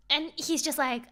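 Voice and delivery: plain reading voice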